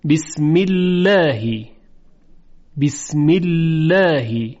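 بسم الله slow, "bismillaa-hi" with a thin l and front vowel; light lam. Shown as a demonstration. Teacher modelling tarqiq after kasra, slow light بسم الله.

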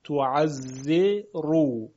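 «تعزرو» stretched slowly, syllable by syllable; very slow teacher's breakdown of «تعزرو»; drawn-out demonstration. Shown as a demonstration. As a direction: hold the "ze" in «تعزرو», then a separate long "ru". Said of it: "ما قبلش ز".

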